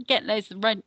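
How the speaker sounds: ordinary talk, nothing marked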